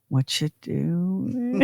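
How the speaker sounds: drawn-out phone greeting; future lonely mother. Pretend. Mom someday phoning her kids, slow mock needy-mother voice. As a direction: stretch it out like a mother with nothing to do. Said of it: "pick up the phone and say".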